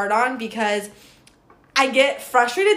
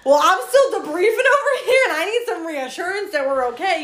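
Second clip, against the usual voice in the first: whiny plea; 6 semitones higher than usual. Retold or imitated. Pleading wife needing reassurance after fight.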